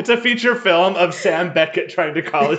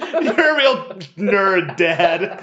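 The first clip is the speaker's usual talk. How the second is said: raised, drawn-out jab through laughter; laughing insult; 5 semitones higher, much slower than usual. Acted. Son mocking his dad on the phone, laughing insult.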